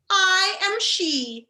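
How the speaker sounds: put-on high, slow little old lady; lightly voiced old witch, unbothered; a hint of a sweet old lady; casual old lady. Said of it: "said the old lady casually".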